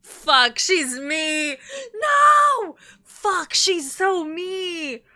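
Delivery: high, loud wail with stretched words; dismayed outcry. Real, not acted. Streamer seeing herself in a character, loud wailing dismay. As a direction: cry out in exaggerated despair.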